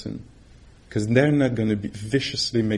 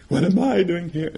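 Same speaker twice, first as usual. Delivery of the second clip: "What am I doing here?" pitched higher and a bit louder, lightly bewildered; mock bewildered meditator; 7 semitones higher, 4 dB louder than usual. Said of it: "you know, and".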